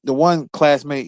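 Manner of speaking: ordinary talk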